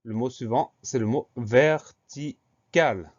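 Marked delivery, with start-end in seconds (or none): 1.3-3.1 "vertical" slowly split as ver-ti-cal; syllable by syllable. Shown as a demonstration. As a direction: dictate "vertical" in three separate, drawn-out syllables. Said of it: "Alors, première syllabe".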